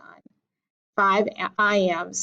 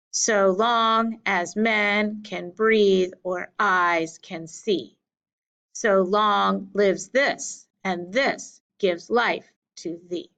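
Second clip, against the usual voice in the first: slow, measured "so LONG as MEN", stress on every second syllable; iambic reading; slower than usual. Shown as a demonstration. Teacher reading the sonnet's closing couplet in iambs, measured beat. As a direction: pace out "So long as men can breathe" pair by pair. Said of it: "If you divide it into iambs".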